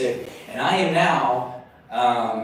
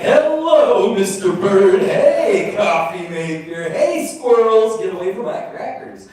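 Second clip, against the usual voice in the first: high, bright sing-song hellos to everything; giddy chirpy greeting; 5 semitones higher, 4 dB louder than usual. Retold or imitated. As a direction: greet the birds like a lovestruck teen. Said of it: "having a zippity-doo-dah morning".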